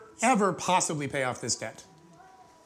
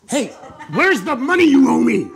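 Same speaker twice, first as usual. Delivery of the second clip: loud, higher menacing demand; intense demand; 7 semitones higher, 11 dB louder than usual. Acted. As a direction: loom over him and demand the money. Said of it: "that's fairly intense".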